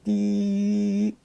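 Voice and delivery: high, smooth held "dee" beep; sine wave tone. Shown as a demonstration. Teacher imitating a sine wave for students, high steady tone. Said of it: "That's what a sine wave looks like".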